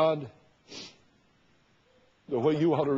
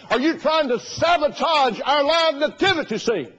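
high, loud and slow accusing voice; hammed-up outraged caller; mock indignant pastor; 11 semitones higher, 6 dB louder, slower than usual. Preacher imitating the Southside pastor accusing him.

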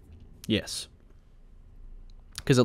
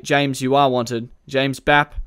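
ordinary delivery

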